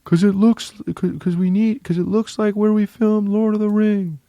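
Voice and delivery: very high falsetto little-person voice; squeaky miniature character, stumbling into the explanation; tiny filmmaker voice. Retold or imitated. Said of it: "he goes like this".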